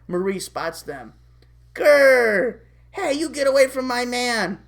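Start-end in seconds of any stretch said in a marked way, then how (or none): 1.7-2.6 loud, higher cartoon snarl; angry growl. Marie spotting Double D with Candace, loud jealous growl. 2.9-4.6 higher, acted possessive warning; jealous confrontation. Marie confronting Candace over her man, jealous warning.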